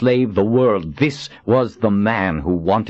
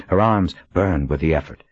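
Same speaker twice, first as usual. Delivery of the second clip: plain reading voice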